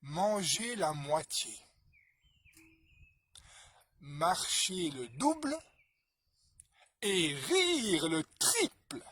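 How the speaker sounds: lent et solennel, plus fort; énonce chaque conseil comme une sagesse; sage maître. Acted. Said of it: "le maître, il a répondu". (le grand maître répond avec lenteur solennelle)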